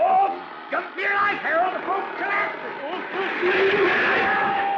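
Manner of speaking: high, loud alarmed yell, last word drawn out slowly; panicked shout. Acted. Doc warning Harold as the float collapses, yelling in alarm. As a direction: scream a warning as it all comes down.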